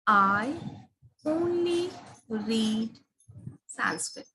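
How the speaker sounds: "only read" drawn out slowly, "Sanskrit" dropped low; stress on only. Shown as a demonstration. Teacher demonstrating the second sentence, slow stress on only. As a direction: stretch "only read" slowly, let "Sanskrit" fall away. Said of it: "these three sentences".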